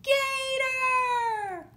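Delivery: high, loud shriek of the word; scared scream. A narrator's reading. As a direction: scream it like a frightened little frog. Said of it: "the frog screams".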